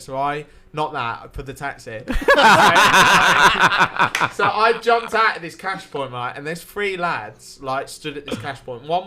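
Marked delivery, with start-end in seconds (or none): 2.1-4.3 loud laugh. Real, not acted. Laughing at own taxi story.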